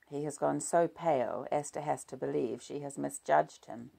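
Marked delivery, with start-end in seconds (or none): none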